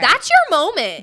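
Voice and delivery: high, loud and slow; loud slow high declaration; emphatic shout. Real, not acted. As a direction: hammer it home to her. Emphatic hype for friend.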